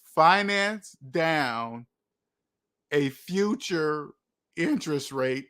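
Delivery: very slow, with gaps between phrases; deliberately slow. Shown as a demonstration. Host showing viewers why the phrase makes no sense, deliberately slow. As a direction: stretch each word out to expose it. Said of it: "I have to say it slow because".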